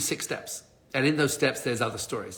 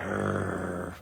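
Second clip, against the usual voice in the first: low, drawn-out growl of frustration; frustrated growl; 9 semitones lower, 9 dB quieter than usual. Retold or imitated. Traveler told he's spending the night in Toronto, frustrated growl. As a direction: growl low and quieter, retelling your frustration. Retells his frustration at news.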